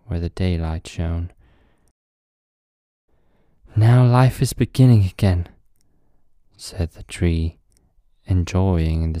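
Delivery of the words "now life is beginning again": loud, high joyful exclaim. Acted. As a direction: burst out with new hope. Tree glad in daylight.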